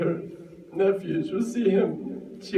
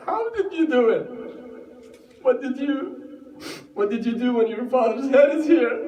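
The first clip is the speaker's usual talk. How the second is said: anguished question; 7 semitones higher, faster than usual. Acted.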